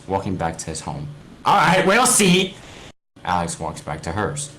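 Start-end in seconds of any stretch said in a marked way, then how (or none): 1.4-2.5 very high, loud, slow taunt; mocking retort. Alex taunting Steve as they part, mocking. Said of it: "Alex retorts".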